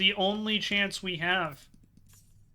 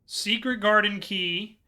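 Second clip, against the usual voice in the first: normal talking voice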